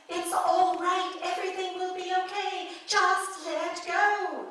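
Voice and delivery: high, drawn-out British lady, almost singing; British female voice. Acted. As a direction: coax him from above in slow British tones. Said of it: "it has a British accent".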